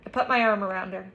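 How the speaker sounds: ordinary delivery, nothing marked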